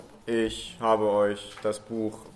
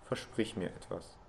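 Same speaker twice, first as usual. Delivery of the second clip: plain reading voice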